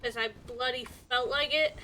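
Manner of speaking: usual speaking voice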